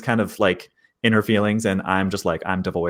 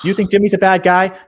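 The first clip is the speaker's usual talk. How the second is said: louder and higher on the repeat; raised voice; 9 semitones higher, 6 dB louder than usual. Acted. Character challenging the others, louder. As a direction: push it up, jabbing at JIMMY'S.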